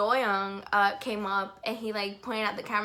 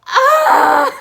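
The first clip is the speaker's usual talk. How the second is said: a much higher, much louder shriek; fangirl scream; 19 dB louder than usual. Real, not acted. Fan hears idol greet her, high excited shriek. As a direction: let out a starstruck scream.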